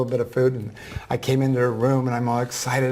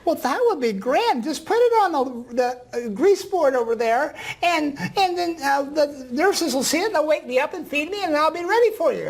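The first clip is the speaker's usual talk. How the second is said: put-on old woman voice; 14 semitones higher than usual. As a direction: voice the ninety-four-year-old gently. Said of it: "I'm gonna use a voice that s-, sounds like her".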